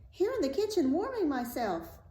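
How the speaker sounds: high little character voice; small, high-pitched reply; answering drop of blood. A narrator's reading. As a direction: pipe up as a tiny creature.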